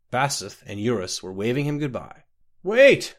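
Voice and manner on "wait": loud, high call after the carriage; calling out from a galloping carriage; shouted cry. Acted. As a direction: shout to stop them leaving. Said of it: "Scotty cried".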